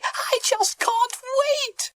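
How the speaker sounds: very high, drawn-out eagerness; squeaky-high little character; excited. A narrator's reading. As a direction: squeal it with anticipation. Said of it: "asked Bonnie excitedly".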